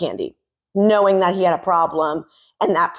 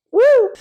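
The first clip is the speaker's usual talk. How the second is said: short, loud, high-pitched woo; high whoop; 6 dB louder than usual. Real, not acted. Commentator whooping at the sign-off, high and loud. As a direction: let out a quick high whoop.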